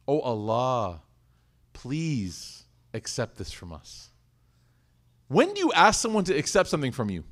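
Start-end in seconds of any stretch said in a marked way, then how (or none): 0.1-1.0 humble plea. Acted. Humble prayer after building Kaaba. 1.7-4.1 slow, soft, humble prayer; humble plea. Acted.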